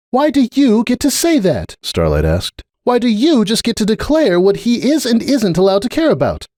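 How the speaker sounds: a lightly voiced unicorn demanding an answer; Starlight. A narrator's reading. Starlight challenging Cupcake's right to decide, indignant.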